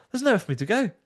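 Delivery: mock protest. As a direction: plead lightly that you're stuck. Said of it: "I was like".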